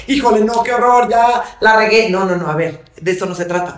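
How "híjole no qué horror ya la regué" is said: higher, louder self-reproach, acted; mock dismay. Parent blaming herself for being human, mock dismay. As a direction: play at being aghast at your mistake. Acts out self-blame over a mistake.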